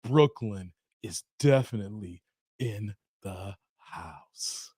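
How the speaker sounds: very low, slow, hushed quote; deep deliberate movie-character delivery; film line impression. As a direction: intone it deep and spaced out, fading away. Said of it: "And when he was like".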